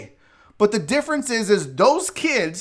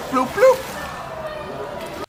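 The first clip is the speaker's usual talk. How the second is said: squeaky high plopping noise, much higher pitch; high splashy bloop sound; 4 dB quieter than usual. Shown as a demonstration. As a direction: pop the bloops up high like a baby hitting water. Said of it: "it's like bloop, bloop".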